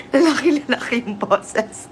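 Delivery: words broken up by laughter; helpless giggle through the words; laughing. Real, not acted. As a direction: read it out while cracking up. Laughing at comment on manly voice.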